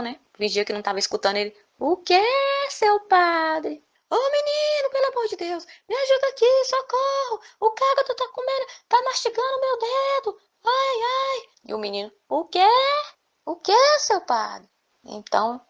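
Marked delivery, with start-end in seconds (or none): 1.8-3.8 feigned innocent call. Acted. Boy pretends not to hear. 4.1-11.5 put-on very high, loud, frantic calls to the boy; desperate pleading scream. Priest desperately calling the boy for rescue, shrieking. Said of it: "E ele ficou desesperado". 12.3-14.7 high, slow, teasing call back; feigned innocent boy. Acted. Boy pretending not to hear the priest, slow innocent call.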